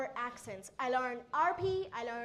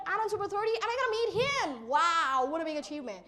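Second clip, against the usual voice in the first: thrilled; 4 semitones higher, faster than usual. Retold or imitated. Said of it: "and I was like".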